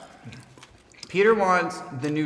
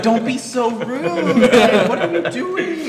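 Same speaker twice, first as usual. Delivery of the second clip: scolding friend; 8 semitones higher, 5 dB louder, slower than usual. Retold or imitated. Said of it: "And I'm like".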